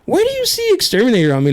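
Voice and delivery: high, fast incredulous question; rapid, high-pitched mock outrage; retold indignation. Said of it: "And I was like".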